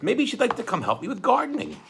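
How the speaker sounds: high, chirpy judge voice; a kindly judge's high-pitched concern; Justice Strauss. Acted. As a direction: fuss over the kids in a bright high voice. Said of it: "Justice Strauss said".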